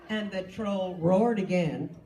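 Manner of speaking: ordinary talk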